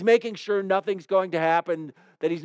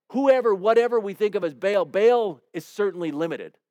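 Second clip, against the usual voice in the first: ordinary talk, nothing marked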